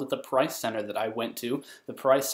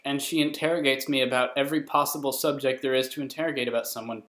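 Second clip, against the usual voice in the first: ordinary delivery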